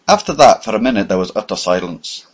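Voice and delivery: ordinary delivery, nothing marked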